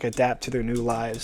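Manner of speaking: usual speaking voice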